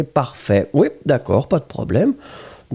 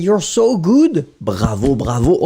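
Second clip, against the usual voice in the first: ordinary delivery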